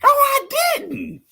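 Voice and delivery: loud high denial; indignant playful denial; protesting. Real, not acted. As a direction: squeal no in protest. Denying during playful argument.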